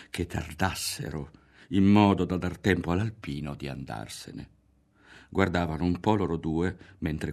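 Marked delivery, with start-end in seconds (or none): none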